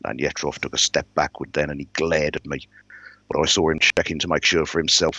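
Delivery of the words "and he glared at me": ordinary delivery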